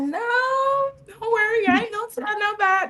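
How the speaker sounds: squeaky mock-mom reassurance; imitating sweet mom. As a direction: put on mom's sweet reassuring voice, way up high. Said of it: "That's how she talks. She's so sweet".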